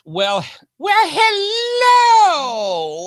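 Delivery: put-on high shrieking old lady voice; Missus Doubtfire voice. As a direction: belt it out like a shrill granny. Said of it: "the Miss Doubtfire thing".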